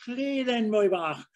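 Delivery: slow, stretched-out Welsh "my bach"; Welsh accent. Shown as a demonstration. Describing Welsh dialect to an interviewer, slow Welsh lilt. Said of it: "the Welsh dialect, they elongate".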